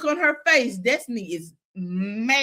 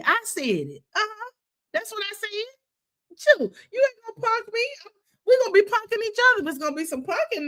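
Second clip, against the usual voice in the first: high-pitched, sassy standing of ground; defiant comeback; 6 semitones higher than usual. Acted. Host playing the woman who admits cussing, defiant. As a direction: act out owning it and refusing to back down.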